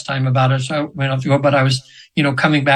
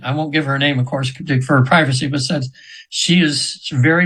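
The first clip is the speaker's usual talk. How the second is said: normal talking voice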